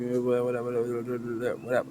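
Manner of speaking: soft, low-key trailing mumble; dismissive mumble. Acted. Goblin waving away the plundering details, dismissive mumble. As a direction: let the repeats run together and fade right down.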